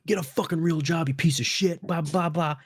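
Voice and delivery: hammed-up insult; retelling the homeowner's rant in a mild mock yell; mock angry yell. Homeowner cursing at a salesman, mock angry yell.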